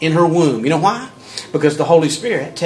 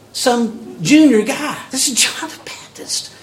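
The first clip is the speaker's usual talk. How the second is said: ordinary delivery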